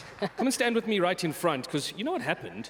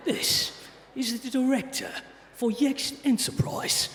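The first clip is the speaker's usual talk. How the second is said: put-on Jason Statham British accent. Host re-introducing the speaker to the audience, in a tough British accent. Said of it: "I'll do the British accent, your whole re-intro, British accent, but as Jason Statham".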